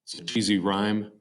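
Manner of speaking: usual speaking voice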